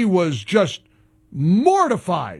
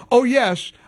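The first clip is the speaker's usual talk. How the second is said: usual speaking voice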